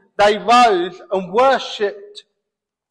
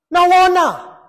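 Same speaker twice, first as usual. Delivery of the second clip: "honour" shouted, much higher and louder; loud emphatic; 7 semitones higher, 6 dB louder than usual. Real, not acted. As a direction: cry out "No honour" in outrage. Repeated louder.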